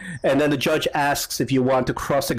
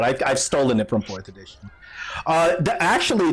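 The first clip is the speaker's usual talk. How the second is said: usual speaking voice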